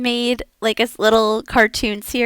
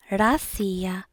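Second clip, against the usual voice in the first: whisper; 6 dB quieter than usual. Shown as a demonstration. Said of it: "whispered drill take".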